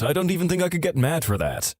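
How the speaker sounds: ordinary delivery, nothing marked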